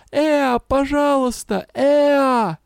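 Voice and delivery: hoarse, slow, high plea; hoarse pleading. A narrator's reading. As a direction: beg with a worn voice. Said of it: "Голос стал сиплым".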